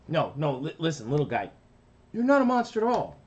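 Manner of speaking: cartoon big brother reassuring. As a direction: rush to comfort the little guy. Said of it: "brother reassuring".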